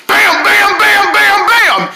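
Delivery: high, loud bam bam; loud imitated wall banging; banging noise. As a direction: pound out each bam.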